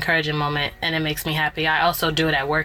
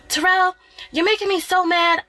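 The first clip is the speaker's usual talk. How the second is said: much higher, mock little-girl complaint; imitating her daughter; 12 semitones higher than usual. Daughter scolding her brother, high imitated kid voice. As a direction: squeak it out like an upset kid sister. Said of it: "she'll go".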